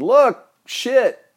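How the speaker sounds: high, loud squawk; comic panicked outburst; mock blurt. Imagined blurting on stage.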